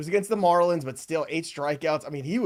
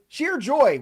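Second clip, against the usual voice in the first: gleeful repeat; 10 semitones higher, 6 dB louder than usual. Real, not acted. Host repeats with gusto.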